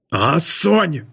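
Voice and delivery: restrained angry roar. A narrator's reading. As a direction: stretch the word as an angry warning. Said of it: "Ordított rá Kaderus".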